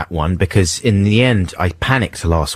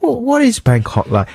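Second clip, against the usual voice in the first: tourist voice. Retold or imitated. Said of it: "accent I did there".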